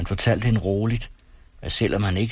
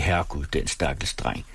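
lower, softer pitying gossip; mock pity; 4 semitones lower, 3 dB quieter than usual. A narrator's reading. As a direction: sneer pityingly, under the breath. (hånligt medlidende, dæmpet)

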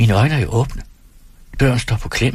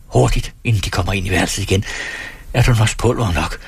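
ordinary talk, nothing marked

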